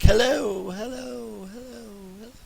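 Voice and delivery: high echoing hellos fading away; cave echo. Shown as a demonstration. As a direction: imitate an echo dying out in a cave. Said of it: "and it's like".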